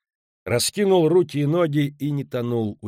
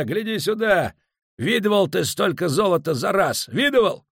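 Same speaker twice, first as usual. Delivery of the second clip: much higher, loud ranting; drunken rant; 10 semitones higher, 5 dB louder than usual. Acted. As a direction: yell insults like a drunk lout. Muus rants insulting the landlord.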